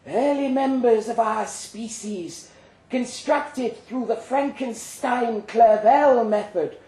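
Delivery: a monster surgeon addressing its audience; slow, loud rasping creature announcement. Acted. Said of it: "said a rasping voice".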